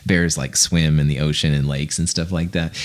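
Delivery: ordinary talk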